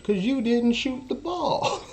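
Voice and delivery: high, slow mock coach shout; hammed-up shout at a player; coach yelling. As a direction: drag it out like a coach scolding.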